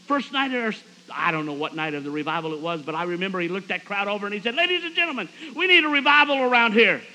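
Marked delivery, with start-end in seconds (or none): 4.5-7.0 high, loud mock platform rant; ranting and raving. Church usher addresses the revival crowd, ranting and raving. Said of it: "Bill is ranting and raving".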